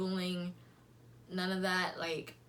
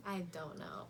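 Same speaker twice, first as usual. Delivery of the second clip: whispered aside; 2 semitones lower, 8 dB quieter than usual. Real, not acted. Aside about his name.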